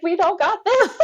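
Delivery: a mock-panicked laughing wail. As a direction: blurt it out, half laughing in panic. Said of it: "I'm panicking at this point".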